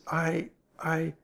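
halting repeat, left hanging; falter, then stop short; breaking off abruptly. A narrator's reading. Ellery hearing something behind the door, breaking off. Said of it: "he stopped abruptly".

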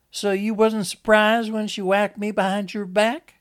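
high, loud, accusing question; challenging Lizzie. Acted. Lizzie challenges Enoch about his wife, high, loud and accusing. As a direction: confront him, voice held up high.

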